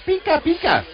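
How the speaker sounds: high squeaky Pikachu call. Retold or imitated. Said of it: "All Pikachu says is".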